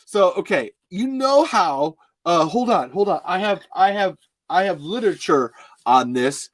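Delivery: hammed-up impression of an excited friend; Chris. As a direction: build to a drawn-out, booming big reveal. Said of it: "I'm gonna try to do Chris now".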